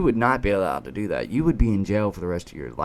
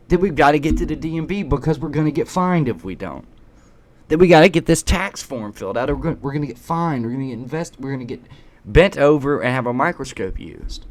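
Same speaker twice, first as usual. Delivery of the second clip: louder, rising fury over fines and taxes; angry rant; 4 semitones higher, 5 dB louder than usual. Real, not acted. Ranting to the audience about being fined, rising anger. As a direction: build the outrage line by line. Ranting for the hard-working public.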